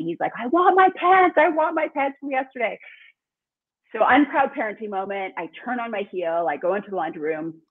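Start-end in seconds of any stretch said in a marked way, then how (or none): 0.3-2.8 imitating a screaming toddler. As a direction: scream it like a tantrum-throwing three-year-old. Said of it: "he's screaming at me".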